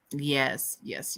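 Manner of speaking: ordinary talk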